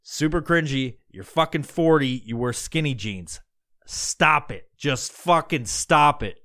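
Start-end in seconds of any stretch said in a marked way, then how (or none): none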